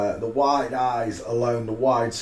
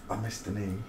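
I missed the knee said softly under the breath; quiet muttered I missed the knee; muttered aside; 2 semitones lower, 10 dB quieter, faster than usual. Real, not acted. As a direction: mumble it to yourself. Muttered to self.

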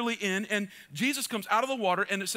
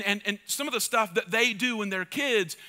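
usual speaking voice

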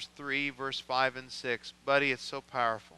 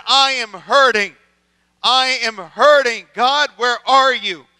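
loud, pleading cry to God; anguished prayer; 8 semitones higher, 16 dB louder than usual. Acted. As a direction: cry it out as a hurting man. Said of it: "And he said".

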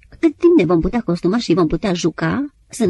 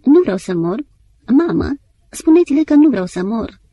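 retold whispered plea; 5 semitones higher, 6 dB louder than usual. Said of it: "șoptind printre suspine".

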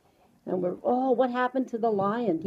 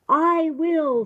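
much higher storybook mouse voice; little mouse; 8 semitones higher, 4 dB louder than usual. A narrator's reading. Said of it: "said the little mouse".

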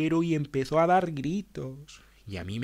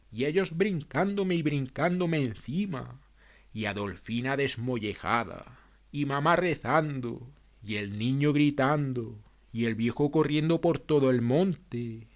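ordinary delivery, nothing marked

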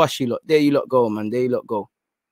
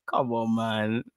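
ordinary talk

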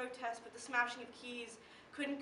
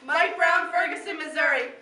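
loud, forceful naming of the dead; shouted roll call; 2 semitones higher, 13 dB louder than usual. Acted. Poet names a slain man and town, shouted roll call. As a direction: call the name out for all to hear. Repeats the victim's name, shouted.

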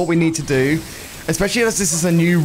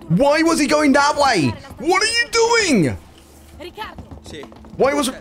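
baffled outcry; 9 semitones higher than usual. Real, not acted. Streamer watching a character wander off, high baffled cry.

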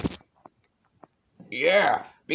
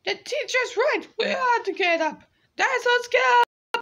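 put-on squeaky kid voice. High kid dinosaur voice.

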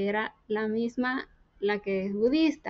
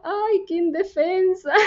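a slow, higher sigh of tender pity; touched pity; 6 semitones higher, slower than usual. Retold or imitated. Digna seeing the defenceless student, slow tender pity. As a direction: melt at how helpless she looks. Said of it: "el Espíritu Santo como que la tocó".

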